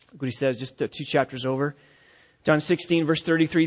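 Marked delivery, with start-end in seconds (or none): none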